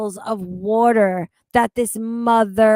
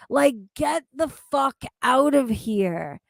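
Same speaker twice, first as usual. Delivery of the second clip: normal talking voice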